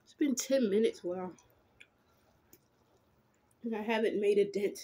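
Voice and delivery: chewing while talking; talking between bites of food; mouth full of noodles. Real, not acted.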